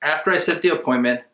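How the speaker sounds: ordinary delivery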